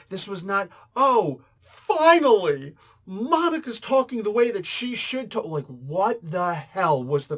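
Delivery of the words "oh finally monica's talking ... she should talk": mock elation. Critic parodies fans welcoming Monica's new way of talking, mock elated. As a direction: gush it in sarcastic celebration, pitched way up. Mocking imagined fans' relieved praise.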